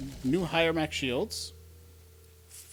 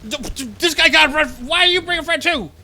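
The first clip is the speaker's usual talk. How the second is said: high, loud frantic yelling; panicked shrieking; 13 semitones higher, 11 dB louder than usual. Real, not acted. More enemies arriving.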